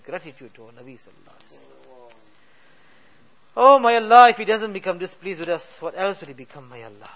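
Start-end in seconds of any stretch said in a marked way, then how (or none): none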